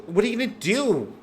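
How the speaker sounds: high-pitched, swooping demand; alarmed question. Acted. Targeted girl questions her helpers, high and alarmed. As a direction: ask it high, as if uneasy.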